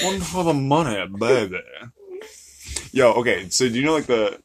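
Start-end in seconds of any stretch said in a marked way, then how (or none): 0.0-1.6 seductive rock-and-roll star, played for laughs. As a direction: pitch it up, then drop onto the last word.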